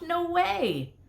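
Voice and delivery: high, dismissive scoff. Acted. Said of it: "I scoffed".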